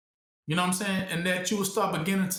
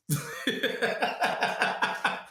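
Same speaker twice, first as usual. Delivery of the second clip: breathy laugh; 5 semitones higher than usual. Real, not acted. Laughing at own joke.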